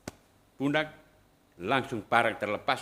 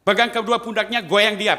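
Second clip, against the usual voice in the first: commanding voice; 8 semitones higher, 9 dB louder than usual. Retold or imitated. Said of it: "ada suara bilang".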